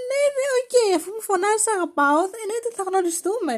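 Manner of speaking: high-pitched sarcastic acceptance; mock agreement. Girls answering a shouting stranger, mock agreeable.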